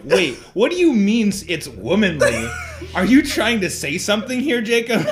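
high, loud challenge with laughter; playfully confrontational questioning; mock offended. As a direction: retell it as a hammed-up accusation, amused. Acting out partner's offended texts.

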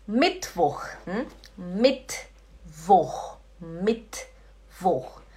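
"Mittwoch" as Mit, voch; clean German t. Shown as a demonstration. Teacher modelling for learners, "Mittwoch" split at bare t. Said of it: "Aí você fala Mittwoch".

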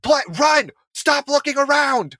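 high-pitched sideline coach yell; mock dad-coach shouting; imitated yelling. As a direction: holler like a dad coaching from the touchline. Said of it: "all he would yell is like".

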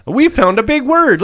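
high, loud mocking voice; high, loud parody of the writer. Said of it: "Were they just trying".

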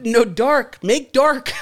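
high, loud broken-English order; mock shouting. Miming lights out for Jorge, mock shouting. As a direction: push each word out loudly, hammed-up.

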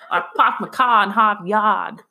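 broad New England accent, r's dropped; hammed-up Boston-style accent, laughing; northern accent. Shown as a demonstration. Showing off the family's accent on request, broad northern accent. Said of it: "That northern accent".

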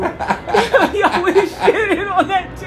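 real laughter through the words; laughing hard. Real, not acted. Laughing at the memory, laugh heard.

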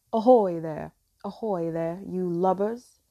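low, loud pirate captain's hail; Captain Hook shout. A narrator's reading. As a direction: bellow low like a pirate captain calling to his crew. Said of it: "sounded just like Captain Hook, shouting".

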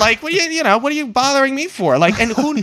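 high-pitched mock irritation; exasperated rant. Ranting at the stranger in her tent, high mock exasperation.

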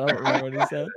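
ordinary talk

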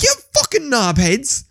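slow, high mock outrage; exasperated at Netflix, a slow high mock insult. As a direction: call them knobheads, drawn out and high. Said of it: "I was like".

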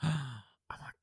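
hushed whisper; breathy whisper. Real, not acted. Gasping at the candy.

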